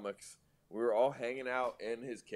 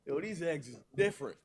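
Guy Fieri impression; 5 semitones higher than usual. Guy Fieri bit continues.

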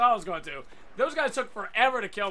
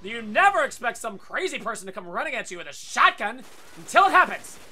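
usual speaking voice

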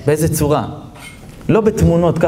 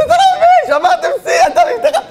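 high, giggling burst of joy; excited wife; 4 dB louder than usual. Acted. Wife bursting with laughter at her husband. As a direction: squeal the news through laughter.

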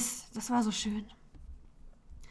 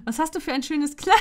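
bursting into laughter; 10 semitones higher, 4 dB louder than usual. Acted. Pupil jokes, bursts out laughing.

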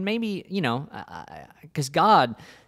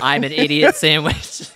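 mock contestant, slow and louder; 2 semitones higher, 6 dB louder, slower than usual. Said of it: "Say it with me".

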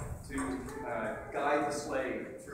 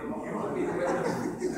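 laughing; 6 dB louder than usual. Real, not acted. Joking about the impression failing.

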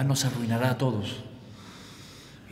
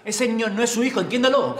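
raised, higher voice, hammering it home; insistent outburst; 12 semitones higher, 6 dB louder than usual. Acted. Man insists to the woman that the boy isn't hers, loud and high. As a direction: make her accept it, loudly. Confronting the mother in court.

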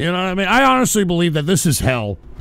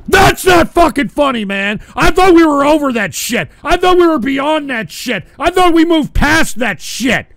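loud, high furious yelling; angry shouting; 8 semitones higher, 6 dB louder than usual. Real, not acted. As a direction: yell at them to stop. Yelling at the prank video.

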